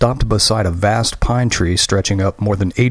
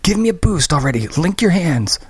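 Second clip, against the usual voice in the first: impatient orders; 9 semitones higher than usual. Acted.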